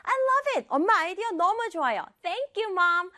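put-on high-pitched delighted child; happy, thankful. Daughter loving mother's idea, high happy thanks. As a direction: gush high and gratefully. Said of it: "지율이가 이렇게 기분이 좋아졌는지".

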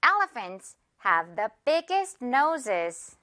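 Elephants have the biggest noses, word by word, very slowly; slow classroom model sentence; very slow demo. Shown as a demonstration. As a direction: model each F slowly for repeating. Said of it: "One more time".

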